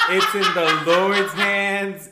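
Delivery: loud, slow churchy proclamation with a laugh; mock preacher. Friend hams up the Lord's will, laughing and preaching. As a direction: preach it like a holy sermon.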